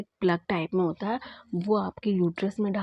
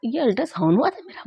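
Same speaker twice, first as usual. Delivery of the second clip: surprised disbelief; 5 semitones higher, 4 dB louder than usual. Retold or imitated. Disbelief on reading the board.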